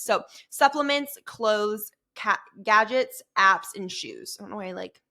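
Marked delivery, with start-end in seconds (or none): 2.1-3.2 gadgets tripped on, cut-off ca- first; stuttered. Real, not acted. Listing her podcast categories, with a real stumble. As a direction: stumble into gadgets after a false start. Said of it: "stuttered on that".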